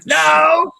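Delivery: loud, high shouted reply; hammed-up high shout; mock shouted no. Acting out a shouted answer.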